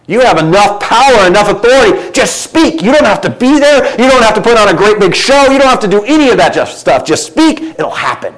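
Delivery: loud, high, building preacher's exhortation; preaching at full force. Acted. Preacher urging the church to just speak, loud and rising. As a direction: drive it home to the congregation.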